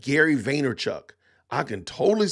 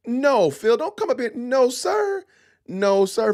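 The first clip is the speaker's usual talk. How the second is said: much higher, louder, playful no sir; shooing someone off; 10 semitones higher, 4 dB louder, slower than usual. Real, not acted. Streamer telling Phil to stay away, high playful protest. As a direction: wave a pal off loudly.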